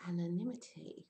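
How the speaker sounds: "Anonymity" whispered, each syllable clear; whispered pronunciation. Shown as a demonstration. Teacher whispers the word to her students. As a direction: breathe out "Anonymity" for the class. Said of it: "or I say anonymity".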